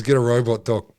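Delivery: ordinary talk, nothing marked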